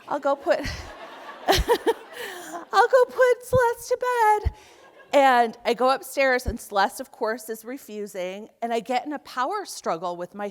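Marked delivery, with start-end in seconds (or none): 0.0-4.5 much higher, slow sing-song with nervous laughs; forced cheerfulness to hide panic; nervous fake cheer. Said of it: "I'm sweating bullets".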